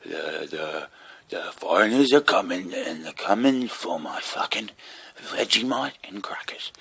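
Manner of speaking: hammed-up mock politician rant; lightly voiced paranoid old bloke, ending in a slow whisper; mocking Dick. Mocking Dick on TV, a hammed-up paranoid rant. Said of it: "He gets up there and he just fucking".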